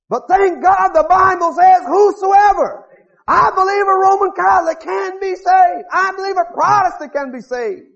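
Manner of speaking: high, loud proclaiming; full-force preaching. Acted. Preacher proclaiming to the crowd that all can be saved. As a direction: build it like a revival sermon.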